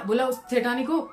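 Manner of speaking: usual speaking voice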